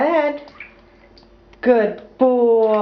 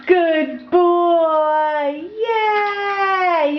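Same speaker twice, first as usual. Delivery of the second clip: excited praise; 7 semitones higher, much slower than usual. Real, not acted. Praising toddler's correct answer.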